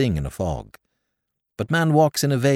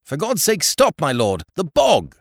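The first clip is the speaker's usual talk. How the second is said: high, urgent frightened plea; 6 semitones higher than usual. Acted. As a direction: beg him to stop, terrified. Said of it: "with a frightened burst of enlightenment".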